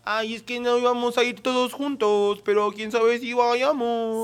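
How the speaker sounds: put-on high, slow, drawn-out posh Puebla fresa accent. Mocking how people in Puebla talk, slow posh Puebla fresa accent. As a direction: put on a dragging upper-class Puebla accent. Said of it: "en Puebla es como".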